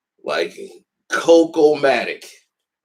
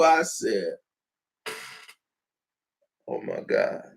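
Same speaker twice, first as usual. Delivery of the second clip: ordinary delivery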